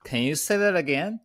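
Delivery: high, puzzled seller asking to repeat; confused seller. Retold or imitated. Ray mimics a confused seller on the phone, high and puzzled. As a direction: ask like a seller who couldn't follow you. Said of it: "the most response I get from the seller".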